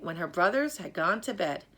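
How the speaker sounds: ordinary delivery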